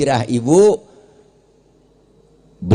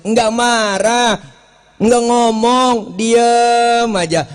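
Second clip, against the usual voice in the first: drawn out very slowly, a bit higher; slow, pointed; 5 semitones higher, much slower than usual. A narrator's reading. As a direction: linger on each phrase to make it sink in. Stressing Abu Bakar's silent endurance.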